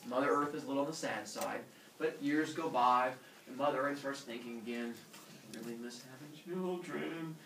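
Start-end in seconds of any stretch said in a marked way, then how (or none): 5.5-7.3 soft, mildly sad musing; wistful longing. Acted. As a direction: think aloud, quietly missing the children. Sad Mother Earth thinking to herself.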